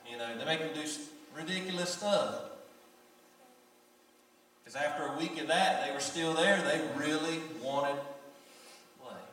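loud, high, fervent sermon delivery; full-force preaching. Acted. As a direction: preach it out to the congregation. Preacher builds again.

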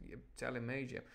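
ordinary delivery, nothing marked

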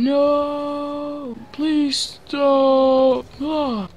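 high, drawn-out wail; desperate pleading. Real, not acted. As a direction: beg in a long high cry. Pleading as play goes wrong.

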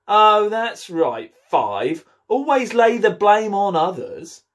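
slow, high and sarcastic; sarcastic gardener. Acted. Seven mocking Five for shifting blame, slow and sarcastic. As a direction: drag it out mockingly. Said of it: "Seven looked up and said".

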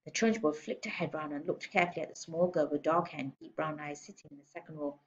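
usual speaking voice

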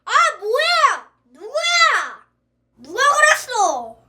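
high, loud startled yelp; shocked outcry. Acted. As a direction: cry out in shocked disgust. Discovers mess, shocked outcry.

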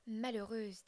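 lancé plus fort, en reproche; apostrophe furieuse. Acted. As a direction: accuse la mère d'un cri. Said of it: "dit-il". (le père accuse la mère, plus fort)

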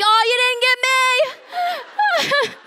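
high, squealed comic taunt; mock triumphant cry. Woman diving behind the couch, high mock taunt. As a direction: yelp it high as if dodging shots.

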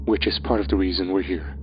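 a hint of a quiet, grave Horseman. Horseman voiced in story.

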